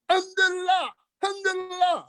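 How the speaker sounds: high, altered voice praising God; imitated foreign voice giving thanks; imitating a joyful believer. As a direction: exclaim it like an overjoyed pilgrim. Voicing Israelis praising God.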